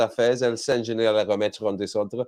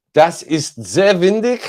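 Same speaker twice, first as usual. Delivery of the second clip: hammed-up German accent, higher, louder and slow; mock German accent, played for laughs; over-the-top German accent, drawn out; exaggerated German accent; 7 semitones higher, 8 dB louder, slower than usual. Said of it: "a bit of casual racism".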